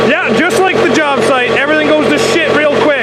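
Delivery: high, rattled rant after a near miss; worked up. Real, not acted. After nearly dropping the engine, a worked-up high rant. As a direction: still shaken and talking fast in a high voice. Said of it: "I'm getting worked up".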